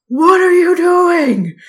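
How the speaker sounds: put-on slow, loud, raspy old man shout. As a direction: bark it like a cranky ancient man. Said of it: "he had this raspy old man voice".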